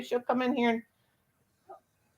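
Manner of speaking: ordinary delivery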